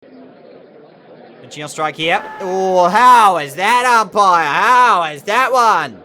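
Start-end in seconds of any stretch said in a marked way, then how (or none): 2.4-5.9 long, drawn-out loud appeal; shouted appeal. Real, not acted. Player appeals to the umpire for the wicket, shouted appeal. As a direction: bellow the appeal at the umpire, stretching the words.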